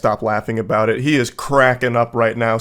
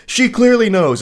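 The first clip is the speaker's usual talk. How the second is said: much higher, louder flat insistence; exasperated outburst; 10 semitones higher, 6 dB louder than usual. Real, not acted. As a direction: hammer the point home, fed up. Exasperated at the boyfriend's texts.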